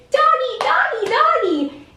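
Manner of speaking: high squeaky "Doggy, doggy, doggy" with a laugh. Shown as a demonstration. Demo of an excited stranger greeting the dog, squeaky. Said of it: "using a squeaky voice and saying".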